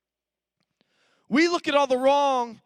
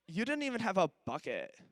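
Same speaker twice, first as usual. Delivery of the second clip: put-on sassy teenager; 4 semitones lower, 8 dB quieter than usual. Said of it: "through the context of ... a fifteen-year-old teenager".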